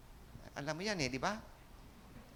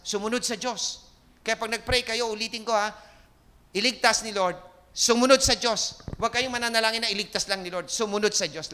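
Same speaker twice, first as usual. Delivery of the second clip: fervent preaching; 5 semitones higher, 6 dB louder than usual. Acted. Preacher urging the congregation to obey God, fervent. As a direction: drive the point home to the congregation.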